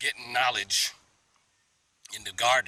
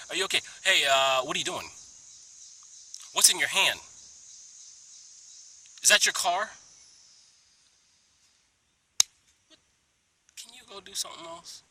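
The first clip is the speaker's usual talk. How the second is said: annoyed; 6 semitones higher, 4 dB louder than usual. Pretend. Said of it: "some people can say".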